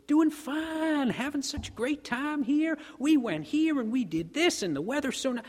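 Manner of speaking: high, cheery, chatty visitor voice; Texan cowboy. Retold or imitated. Cowboy answering the New Yorkers' greeting, high cheery gush. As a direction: gush like a happy tourist with no idea it's a throwaway greeting. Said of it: "And he goes".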